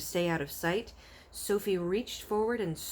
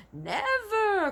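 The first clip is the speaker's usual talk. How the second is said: much higher, louder protest; passionate cry; 6 dB louder than usual. Acted. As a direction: fling it out in outrage. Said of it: "cried the B F G passionately".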